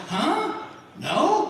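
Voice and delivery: high, questioning, puzzled reply; baffled farmer. Acted. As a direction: answer as if thrown by the question. Farmer baffled by question about nails.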